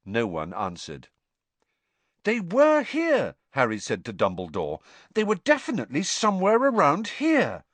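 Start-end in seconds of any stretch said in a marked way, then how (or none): 2.2-7.7 anxious. A narrator's reading. Harry anxious insistence.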